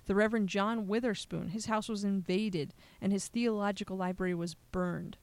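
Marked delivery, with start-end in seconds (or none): none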